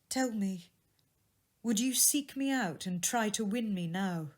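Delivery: put-on young woman's voice. A narrator's reading. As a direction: speak as the jilted sweetheart, hurt but composed. Belle voiced.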